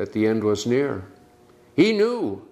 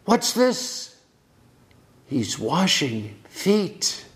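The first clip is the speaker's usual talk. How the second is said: astonished disciple; 4 semitones higher than usual. Retold or imitated. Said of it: "the apostles must have thought".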